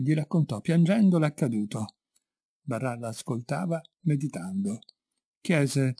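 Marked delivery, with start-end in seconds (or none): none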